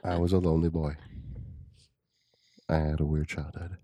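measured, low-key solemn narrator; lightly voiced documentary storyteller with long pauses; Morgan Freeman voice. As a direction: recount it gravely, pausing between phrases. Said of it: "Are you doing, like, Morgan Freeman voice?".

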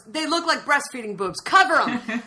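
high, increasingly loud orders; mock appalled director. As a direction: call out urgently to the crew. Voicing director ordering cover up.

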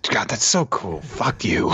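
whispered, grudging admiration; mock grudging whisper. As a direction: hiss it like you hate to admit it's cool. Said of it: "it's like".

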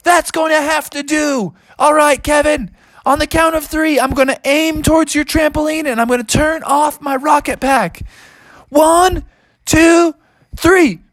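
very high cartoon hero voice; high-pitched daredevil announcing his stunt; Bubby the daredevil. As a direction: count down boldly in a high, strained pitch.

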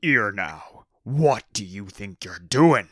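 loud, rough-edged orc boss voice; bellowing orc boss. Acted.

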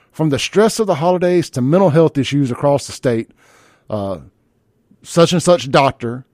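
mocking read of a rival show's post; hammed-up morning-host greeting, settling into dry mockery; mildly condescending. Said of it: "I'm being a little bit condescending".

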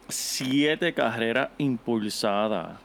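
"siete carreras impulsadas" drawn out word by word; slow, emphatic. Real, not acted. Co-host answers how many he had, slow and emphatic. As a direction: spell out the stat slowly for impact. Stresses stat, then repeats it.

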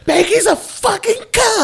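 a hammed-up Becky insult; mock office woman rant. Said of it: "I just hate her".

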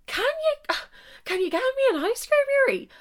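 very high squealed question; mock-scandalised, high-pitched echo; delighted disbelief. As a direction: echo the offer in an incredulous squeal. Delighted incredulous echo of his offer.

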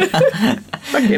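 laughing through the word. Real, not acted. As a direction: breaks into laughter. Laughing after joke.

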